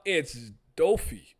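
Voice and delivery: loud, stretched correction with a long O; retold yelled correction. Correcting the panel from the crowd, loud and drawn out. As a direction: call out the name slowly and firmly. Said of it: "I even yelled out".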